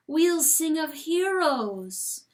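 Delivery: lightly voiced grand, slow menace; a hint of an otherworldly voice, slow. A narrator's reading. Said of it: "says the voice".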